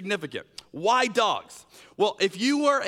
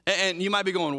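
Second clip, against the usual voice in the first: usual speaking voice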